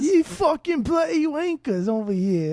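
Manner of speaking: put-on a shrieking British accent caricature; mock British accent. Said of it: "we're not even using the right accent".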